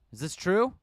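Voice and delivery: loud, hard question; angry interrogation; stern demand. Acted. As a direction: glare and demand it. Susumu glares at his men.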